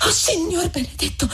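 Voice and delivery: high, trembling woman's cry; shaken, high-pitched exclamation; trembling reply. A narrator's reading. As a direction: gasp it high, overwhelmed. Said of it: "replicò ancor più tremante Lucia".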